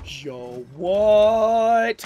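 gasp, then loud shocked what; sudden gasp of discovery; startled gasp. Real, not acted. Discovering admiral's dress.

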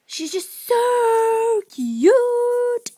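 very high, loud, slow squeal of cuteness; squealing over the puppy. Real, not acted. Gushing over new puppy.

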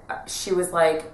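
normal talking voice

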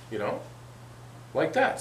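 usual speaking voice

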